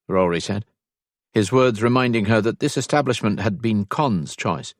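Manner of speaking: plain reading voice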